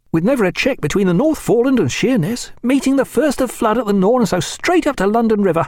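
lightly voiced keen young officer. A narrator's reading. Reed voiced in narrated dialogue.

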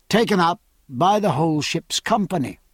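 ordinary delivery, nothing marked

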